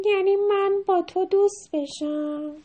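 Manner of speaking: high, slow drawn-out cat question; cat character voice. A narrator's reading. The cat asks about friendship, high slow cat voice. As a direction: draw it out as a storybook cat. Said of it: "گربه".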